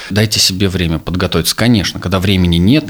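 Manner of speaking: usual speaking voice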